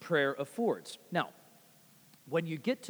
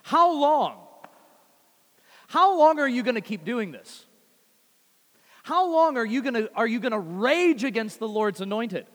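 loud, high, defiant "How long?" rising well above the plain voice; confident challenge shouted at the enemies; cried in confidence; 5 semitones higher, 8 dB louder than usual. Retold or imitated. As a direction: call it out boldly, sure of the outcome. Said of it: "It's cried from a spirit of confidence".

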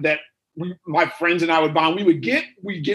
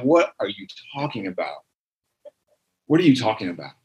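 lower mock bafflement; 8 semitones lower than usual. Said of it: "they start talking like this, you're like".

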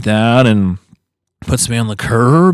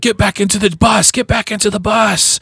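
mock officer yell, higher; police shouting; 10 semitones higher than usual. Officer ordering children back into the bus, mock shout. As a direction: order the kids back like a cop. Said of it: "police officers like".

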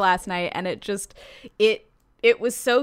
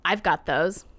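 raspy voice; 3 semitones lower than usual. Retold or imitated. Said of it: "He says in a raspy voice".

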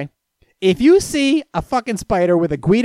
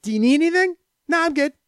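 high mock exchange; 9 semitones higher, faster than usual. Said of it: "that's all I know to say".

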